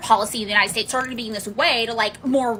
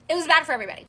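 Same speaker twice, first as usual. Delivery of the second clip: normal talking voice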